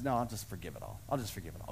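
soft and low, tender reassurance; gentle. Retold or imitated. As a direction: let it fall softly like a kind father. God's gentle answer in retelling.

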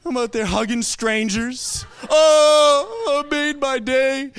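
high, hammed-up wail of joy; mock happy crying. Old man hugging a stranger at baby news, mock weeping. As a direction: imitate an old man bawling happily, slowly. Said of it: "He's crying, I'm crying".

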